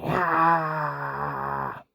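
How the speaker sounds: a real cry escaping; short cry. Real, not acted. Sound after the retold plea.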